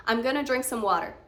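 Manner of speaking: quick "gonna", softened R in "water"; American reduced, fast. Shown as a demonstration. Teacher demonstrates the American version quickly. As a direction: rattle off "I'm gonna" American style. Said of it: "Going to changes into gonna".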